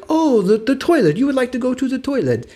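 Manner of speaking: lightly voiced French accent, high and delighted; French shopkeeper accent. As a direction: play the relieved French clerk catching on. Shopkeeper finally understands, accented.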